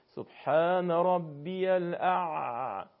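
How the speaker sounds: سبحان ربی الاع- recited very slowly, the ع held long and still; slow long-drawn recitation demo; stretched ع. Shown as a demonstration. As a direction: hold the ع in الاع- for seconds without any vowel.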